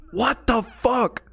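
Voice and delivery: slow, high-pitched disbelief; real baffled shock. Real, not acted. Viewer stunned by what's on screen, baffled shock.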